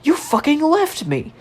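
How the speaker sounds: high, slow mock reproach; imitated accusation. Friend to teller after five seconds' silence, high imitated reproach.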